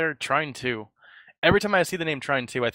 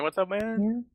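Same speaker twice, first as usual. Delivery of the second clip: silly high voice; 9 semitones higher, much slower than usual. Acted. Greeting repeated in a silly voice.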